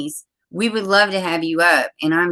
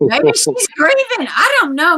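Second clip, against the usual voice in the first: high, slow, loud sarcastic drawl; mock the excuse, voice up high; sarcastic mock sympathy; 12 semitones higher, 4 dB louder than usual. Real, not acted. Host scoffs at the missing girl's mother with sarcastic mock sympathy. Mocking mother seen twerking.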